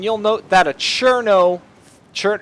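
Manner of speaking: ordinary talk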